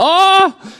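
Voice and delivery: a sudden high cry of fright; short high alarmed shout; panicked yelp. Retold or imitated. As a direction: yelp as the waves scare him. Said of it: "He's like".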